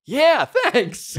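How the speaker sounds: high, mock-eager yeah, thanks; mock eager acceptance. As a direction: grab the offer in a high mock voice. Wife accepts the necklace happily.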